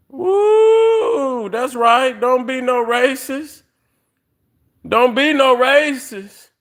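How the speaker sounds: high, cracking exclamation; almost crying, pitch jumps up; emotional cry-out. Real, not acted. Emotional outburst on racism.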